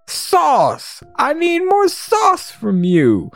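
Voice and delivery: very high, drawn-out demand; ecstatic goblin. Acted. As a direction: crow it high with hammed-up ecstasy.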